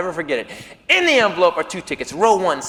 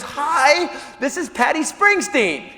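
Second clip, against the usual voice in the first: high-pitched imitation of a woman on the phone; higher female caller voice; 8 semitones higher, slower than usual. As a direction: lightly voice a woman introducing herself, drawn out. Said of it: "It's a woman".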